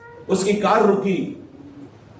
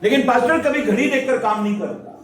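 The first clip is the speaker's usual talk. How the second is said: higher, raised preaching voice; 5 semitones higher than usual. Acted. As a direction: press the lesson home to the flock. Preaches to the congregation. (ऊँची आवाज़ में उपदेश देते हुए)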